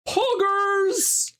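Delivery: very high, drawn-out squeal; mock excitement. Streamer imagines getting a game role offer, mock excited squeal. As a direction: squeal it in over-the-top excitement.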